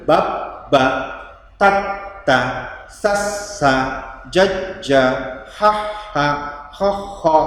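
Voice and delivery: each pair a closed syllable then an open one; syllable drill. Shown as a demonstration. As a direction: drill bab ba, tat ta, sas sa. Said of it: "Bab, ba hidup, ba mati".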